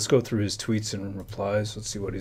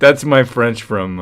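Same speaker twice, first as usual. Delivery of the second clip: louder, breaking out of a laugh; real laughter into speech; 2 semitones higher, 13 dB louder, slower than usual. Real, not acted. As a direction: try to talk through the giggles. Cracking up at own accent.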